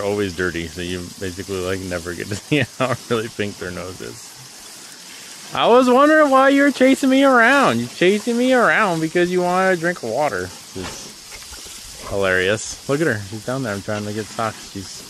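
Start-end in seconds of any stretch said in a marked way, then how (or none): 5.5-10.0 gushing to a pet, much higher and louder; squealy pet talk. Real, not acted. Squealing baby talk at pig.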